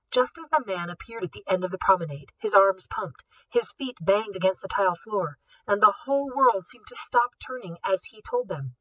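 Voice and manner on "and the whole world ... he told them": plain reading voice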